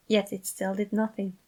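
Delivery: ordinary delivery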